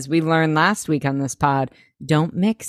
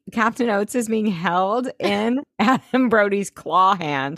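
ordinary talk

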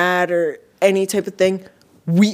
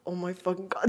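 muttered curse; 15 dB quieter than usual. Retold or imitated. On the stairs, muttering to herself, a soft slipped curse. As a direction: curse mumbled very softly under the breath. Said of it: "that just came out of my mouth".

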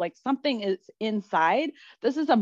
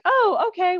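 high, louder 'oh' of dawning understanding; pitched-up, lightly acted surprise; surprised realization; 12 semitones higher, 6 dB louder than usual. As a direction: act out a sudden 'oh, I see'. Acting out her surprised realization.

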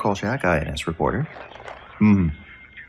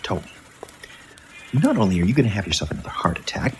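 ordinary delivery

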